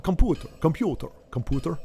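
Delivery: computer said with a hint of Russian vowels; lightly imitating a Russian accent; mock Russian accent. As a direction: try out computer in a half-hearted Russian accent. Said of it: "I don't know why I'm doing that".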